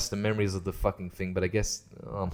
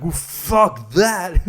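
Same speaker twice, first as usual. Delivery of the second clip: mock drawl; 5 semitones higher, 5 dB louder, much slower than usual. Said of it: "he's like".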